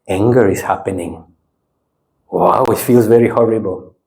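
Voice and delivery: low, detached note of a feeling; calm observing. Shown as a demonstration. Teacher showing how to watch anger arise, calm observing. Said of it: "But if I say, Wow".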